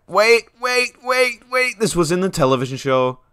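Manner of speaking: high, loud repeated waits; urgent. Real, not acted. Player recognizing something from the show, high urgent waits.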